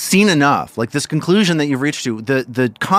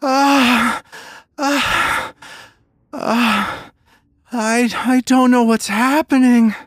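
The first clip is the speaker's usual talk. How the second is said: high, hammed-up sobbing girl; half-comic fake sobs, confused and scared; 14 semitones higher, 3 dB louder, much slower than usual. Crying girl to the party, mock sobbing. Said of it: "and she starts sobbing".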